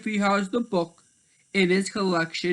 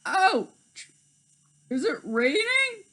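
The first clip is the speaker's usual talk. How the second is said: high pained yelp; high hurt cry; startled leopard; 13 semitones higher, slower than usual. Acted. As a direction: cry out like a wet cat.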